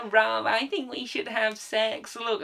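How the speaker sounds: slow, put-on voice of a teenager; hammed-up teen come-on; mock teenage girl. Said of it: "that sixteen-year-old going".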